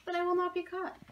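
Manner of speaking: confident baker. A narrator's reading.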